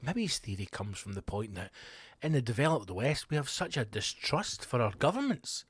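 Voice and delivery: usual speaking voice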